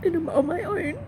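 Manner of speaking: high, tearful voice; trying not to cry. Real, not acted. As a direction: push haltingly through real tears. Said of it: "I don't mean to cry".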